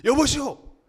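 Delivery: loud, high hail; calling to a passerby. Acted. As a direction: call out to stop someone passing. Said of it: "한 사람을 붙잡고 묻습니다".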